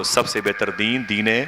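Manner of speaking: ordinary delivery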